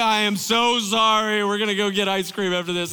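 loud, gushing dad apology; hammed-up making amends to a crying child; mock remorseful apology. Remorseful dad soothes crying son.

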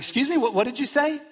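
high, incredulous "what did you say"; mock parental indignation. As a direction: play the parent who can't believe that apology. Parent demanding a real apology.

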